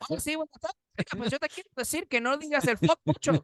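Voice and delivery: high, exaggerated Speedy Gonzalez-style Spanish; mock Spanish accent. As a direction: ham up a cartoon Mexican accent in Spanish. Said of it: "Why does that sound like Speedy Gonzalez".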